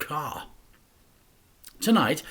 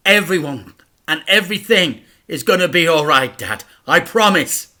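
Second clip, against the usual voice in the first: put-on boy's promise; 5 semitones higher, 11 dB louder than usual. A narrator's reading. Boy reassures injured father.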